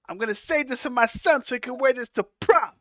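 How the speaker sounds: high mobster voice; Penguin grumbling. Retold or imitated. Penguin grumbling about saving the suit for his son in a high mobster voice. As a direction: complain like a sentimental gangster dad.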